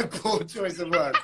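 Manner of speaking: slow, through real laughter; laughing apology. Real, not acted. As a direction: repeat the apology while cracking up. Laughing at own word slip.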